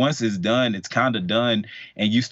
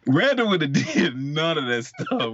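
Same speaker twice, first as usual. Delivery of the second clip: laughing; 5 semitones higher than usual. Real, not acted. Joking about himself, laughing.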